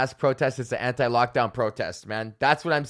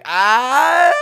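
loud high indignant "ah"; mock outraged ah; 10 semitones higher, 9 dB louder than usual. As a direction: burst out in mock protest. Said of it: "be like".

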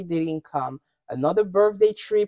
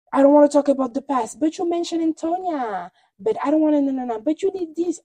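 higher-pitched whiny imitation; hammed-up protesting; mock bickering; 6 semitones higher than usual. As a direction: parody a petty back-and-forth argument. Mimicking bickering relatives.

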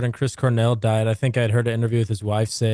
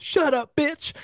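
high-pitched mock snap from the crowd; imitating Charlie Murphy, way up high; mock Charlie Murphy yell; much slower than usual. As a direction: squeal it out like a heckler. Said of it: "Where Charlie Murphy goes".

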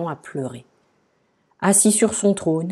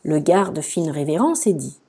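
usual speaking voice

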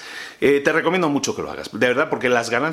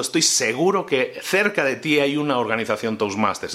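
normal talking voice

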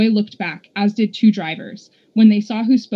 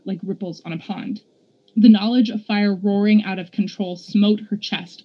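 plain reading voice

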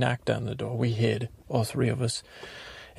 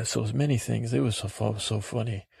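normal talking voice

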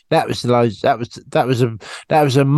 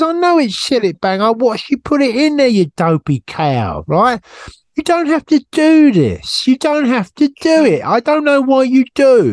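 mock exasperated scolding; 10 semitones higher than usual. Scolding Kim for putting cleaner in tea, mock outrage. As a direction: scold your friend in mock outrage, pitched high.

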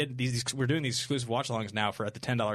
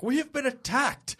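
distress call; 13 semitones higher, 3 dB louder, slower than usual. Retold or imitated. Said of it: "he's like".

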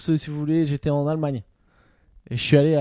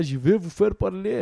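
lightly voiced movie Russian villain, slow and soft; a hint of the menacing Russian baddie; villain Russian accent; 2 semitones higher, 5 dB quieter, slower than usual. Mocking dubbed film villains, a light, slow Russian baddie voice. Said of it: "même le russe dans les films, tu sais, c'est toujours le mec méchant".

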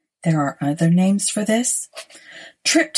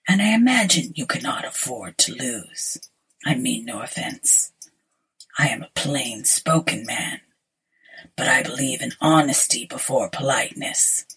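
whispered, low sinister stranger; low looming threat; 5 semitones lower than usual. Acted. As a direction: breathe it like a pale ghost. Pale stranger whispers his lines.

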